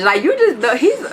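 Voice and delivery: laughing through the words; breaking into laughter mid-thought. Real, not acted. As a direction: giggle as you say it. Laughing about childish ex.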